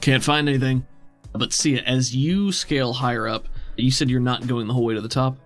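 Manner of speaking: ordinary talk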